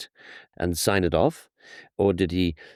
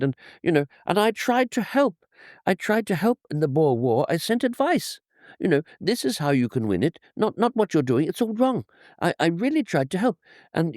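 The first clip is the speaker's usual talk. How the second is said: plangent, wounded plea climbing much higher in pitch; aggrieved ruler pleading to be appreciated, voice rising high; Kaiser Wilhelm the Second; 7 semitones higher than usual. Retold or imitated. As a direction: protest in a high, plaintive put-on accent. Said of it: "That mixture of the plangent tones".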